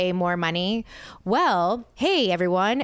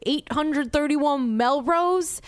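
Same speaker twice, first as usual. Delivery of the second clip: slow, puzzled driver asking the address; confused driver; 6 semitones higher, slower than usual. Retold or imitated. Driver misreads her destination, slow and puzzled. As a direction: drag it out like a lost driver checking. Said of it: "And he's like".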